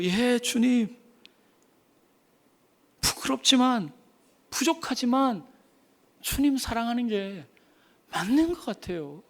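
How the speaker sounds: soft, hesitant, sincere confession; humble answer. Acted. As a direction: answer softly, a bit shy. (조용하고 수줍게 진심으로)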